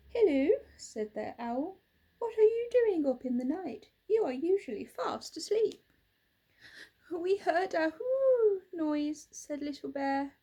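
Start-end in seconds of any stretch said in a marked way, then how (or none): 0.1-0.5 bright owl hello, pitched up and loud; a sweet little owl calling hello; Hoot the owl. Acted. Owl greeting the bear toys, high and loud. 2.2-5.8 higher, louder little owl; a curious owl asking gently. Acted. Owl asking the toys why they're awake, higher voice. 7.1-9.3 slow, high and loud, hooting the whoo; a small bear telling about a scary noise; little bear voice. Acted. Little Bear telling the owl, high and slow.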